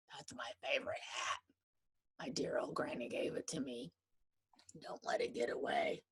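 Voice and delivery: soft unvoiced plea; whispered panic. Teacher begs Bobby to save her hat, in a whisper. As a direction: whisper it urgently.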